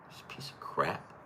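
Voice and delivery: whispered under the breath, annoyed; muttered curse. Real, not acted. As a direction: hiss it to yourself at the screen. Frustrated with slow website.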